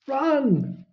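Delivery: sudden sharp yell to run; urgent shout of run. Acted. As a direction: cry out the warning to flee. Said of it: "Harry yelled".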